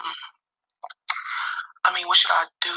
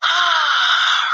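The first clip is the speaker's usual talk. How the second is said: tearful; 11 semitones higher than usual. Real, not acted. Pulling herself together after crying.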